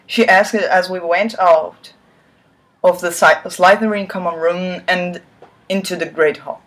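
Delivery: ordinary delivery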